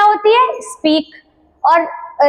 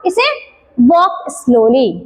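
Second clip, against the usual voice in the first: very slow, stretched model phrase; slow demo; 3 semitones lower, much slower than usual. Shown as a demonstration. Teacher models walk slowly very slowly for kids. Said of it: "English में क्या बोलेंगे".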